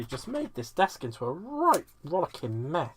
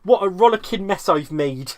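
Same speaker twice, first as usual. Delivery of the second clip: loud, higher broad West Country voice; hammed-up yokel West Country accent; 5 semitones higher, 11 dB louder than usual. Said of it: "in a broad West Country accent".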